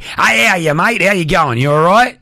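hammed-up Aussie accent; exaggerated Australian accent. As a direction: lay the Australian accent on thick, like greeting a mate. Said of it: "it goes a little bit more Australian. But I'm not like".

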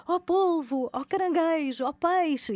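high sing-song call to the sea creatures. Retold or imitated. Calling sea creatures by name. (chamamento alto e cantado)

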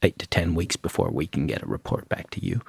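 low, quiet mock American accent; terrible American accent. Toxicologist quoting a Californian lab's delay, bad American accent. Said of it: "That's a terrible American accent".

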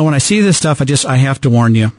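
normal talking voice

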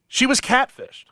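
high, drawn-out disbelief; can you believe it; incredulous. Real, not acted. Shocked story beat.

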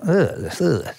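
short, high-pitched "ugh" of distaste; a repeated little groan of dislike; disgusted groan. Retold or imitated. Recalling how he hated LA, disgusted groan.

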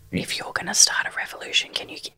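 breathy whisper into the mic. Real, not acted. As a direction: hush it right into the microphone. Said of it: "Stop whispering into the mic".

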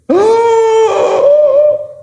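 high startled gasp; loud amazed gasp. Acted. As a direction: gasp in awe. Disciples amazed.

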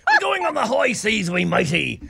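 high-pitched hammed-up pirate. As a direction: ham it up like an old sea dog. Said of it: "I just really love your pirate voice".

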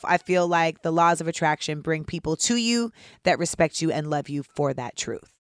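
ordinary talk, nothing marked

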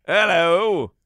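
a cheery English greeting, hammed up; playful British hello; mock British hello. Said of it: "British accent".

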